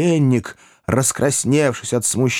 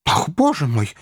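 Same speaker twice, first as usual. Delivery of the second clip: a higher startled cry; startled exclamation; 5 semitones higher than usual. A narrator's reading. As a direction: jump at the sudden fright. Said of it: "воскликнул, вздрогнув". (испуганный возглас)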